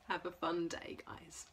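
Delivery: soft whispered farewell; whispered goodbye. Acted. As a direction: whisper goodbye to the little ones. Gentle goodbye to toddlers.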